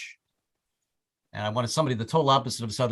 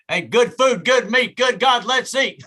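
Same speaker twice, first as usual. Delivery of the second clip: higher, louder sing-song mock grace, slowing at the end; joke prayer; 9 semitones higher, 6 dB louder, slower than usual. Friend Jerry saying the joke prayer at dinner, mock sing-song grace. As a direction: rattle off a goofy grace like a kid clowning at dinner. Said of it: "he would always do the joke prayer".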